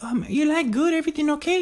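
very high, squeaky mimicry; old lady impression. Old lady checking on their table, high impression. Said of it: "So she's like".

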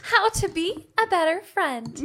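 high, slow, sweet fairy tale princess; Disney princess voice. Shown as a demonstration. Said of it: "but in Disney princess voice".

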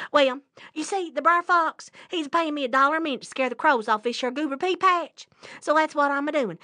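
fast-talking rabbit. Acted. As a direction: rattle it off like a trickster rabbit. Rabbit cons the bear.

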